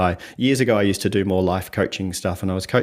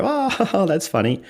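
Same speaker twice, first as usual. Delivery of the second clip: laughing aloud; 9 semitones higher, slower than usual. Retold or imitated. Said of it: "they laugh out loud".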